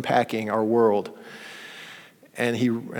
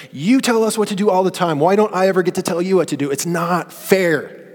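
higher, lightly mimicked child complaining; mock a sulky son's protest; a hint of a whiny kid; 6 semitones higher, 3 dB louder than usual. Son complaining to his parents, lightly mimicked whine. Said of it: "my son comes to us and said".

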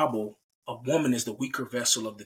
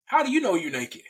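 high, incredulous questioning; God questioning; 7 semitones higher than usual. Acted. God asks how they know they are naked, incredulous. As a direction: press the question in rising disbelief. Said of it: "God's like".

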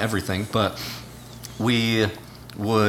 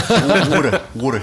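laughing mock Philly "wooder"; hammed-up Philly accent; 6 semitones higher, 6 dB louder, slower than usual. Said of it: "Philly accent".

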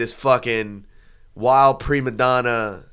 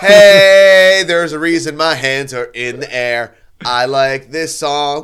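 loud, drawn-out "hey" then stretched-out boast; mock party holler; 3 semitones higher, 9 dB louder, slower than usual. Teasing a friend about dancing hands up, mock party holler. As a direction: holler like a reveler showing off on the dance floor.

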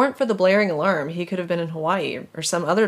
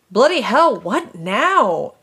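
loud frustrated shout; 5 semitones higher, 5 dB louder, much slower than usual. Acted. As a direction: yell it in exasperation. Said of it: "Snake shouted".

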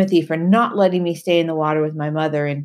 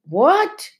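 high, loud startled shout; frightened roar; 14 semitones higher, 5 dB louder than usual. Acted. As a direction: bellow it in sudden fear. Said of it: "His voice was a frightened roar".

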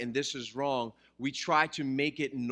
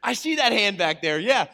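louder, higher call to the back of the room; 6 semitones higher, 8 dB louder than usual. Real, not acted. Pastor calling to a congregant at the back, raised and loud. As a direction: point out a raised hand, up and loud.

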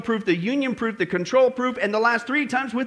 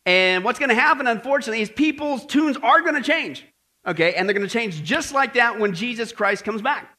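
ordinary delivery

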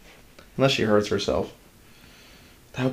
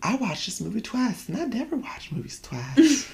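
high, soft put-on southern twang imitating mom; 11 semitones higher, 5 dB quieter than usual. Son mimics his picky mom praising a show, southern twang. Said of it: "She doesn't sound like that at all".

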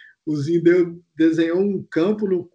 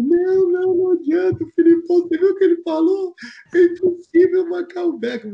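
high, louder, tearful protest; imitating crying panic; 12 semitones higher, 3 dB louder than usual. Teammate insisting Beckham cannot be marked, high tearful wail. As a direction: wail it out, voice pitched way up. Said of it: "Você chorar".

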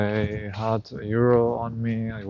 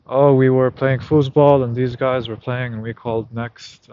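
retold loud excuse; 3 semitones higher, 10 dB louder than usual. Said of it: "woman's reply retold".

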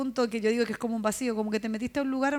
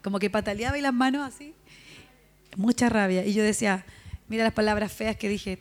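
normal talking voice

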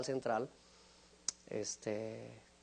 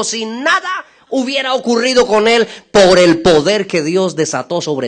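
high, loud climactic testimony; full-force preaching; 9 semitones higher, 20 dB louder than usual. Real, not acted. Preacher proclaims the healing to the congregation, full-force preaching. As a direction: drive the miracle home to the whole hall.

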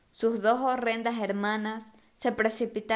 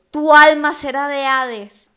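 slow, loud, higher menace; threatening; 5 semitones higher, 12 dB louder, slower than usual. A narrator's reading. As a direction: roar the doom slowly. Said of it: "prometió".

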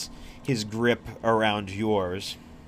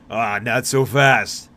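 slow, louder gloating arm-wrestler; sly taunt; 8 dB louder, slower than usual. Acted. Arm-wrestler gloats at player mid-match, slow and louder. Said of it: "he stops and he goes".

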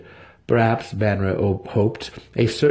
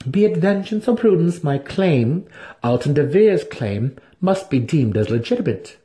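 higher, firm insistence; scheming matron; 7 semitones higher than usual. A narrator's reading. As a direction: press the claim as lawful. Santa Fe insists.

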